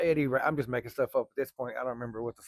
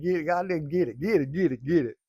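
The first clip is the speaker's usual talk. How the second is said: cheering on; 4 semitones higher, 6 dB louder than usual. Retold or imitated. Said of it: "I said".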